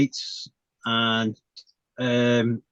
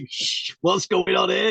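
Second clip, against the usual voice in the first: bewildered; 8 semitones higher, slower than usual. Retold or imitated. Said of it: "I was like".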